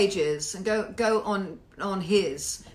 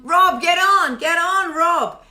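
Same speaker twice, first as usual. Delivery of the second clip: loud, high summons; urgent raised-voice call; 7 semitones higher, 8 dB louder, slower than usual. Real, not acted. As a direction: holler at someone to join in. Summoning Rob to the broadcast.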